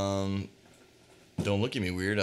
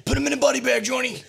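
put-on Karate Kid yell; 13 semitones higher, 5 dB louder, faster than usual. Karate Kid quote acted.

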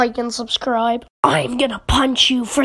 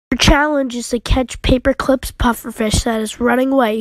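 usual speaking voice

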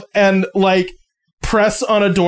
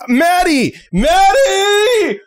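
calling out; 11 semitones higher, much slower than usual. Acted. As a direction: holler the name upstairs. Teen yells for roommate.

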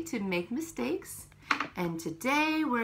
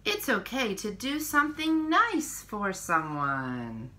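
put-on reading to children. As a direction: read each page to little ones, unhurried. Reads picture book to children.

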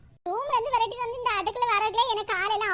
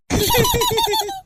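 loud triumphant laugh; 9 semitones higher, 5 dB louder than usual. Acted. Triumphant laugh after song.

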